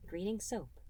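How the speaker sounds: ordinary delivery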